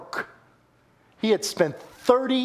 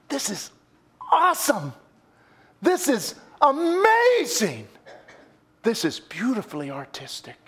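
delighted praise; 7 semitones higher than usual. Retold or imitated. Queen's words restated as praise.

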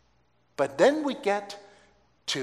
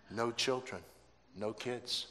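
ordinary delivery

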